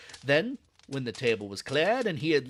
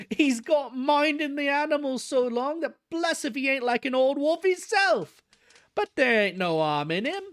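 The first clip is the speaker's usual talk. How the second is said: higher, cheery woman's voice; cheerful wife breaking in, pitched up; 11 semitones higher than usual. Acted. Keeper's wife reassuring the visitor, cheery and higher. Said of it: "broke in Missus Tom with a cheery laugh".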